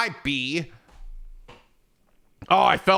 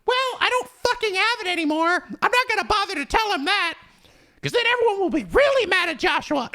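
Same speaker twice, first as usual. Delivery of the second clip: much higher squeaky hammed-up hero whine; high mock hero voice. Hero answering Kurt in a high squeaky whine. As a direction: squeak it out like a put-upon cartoon hero.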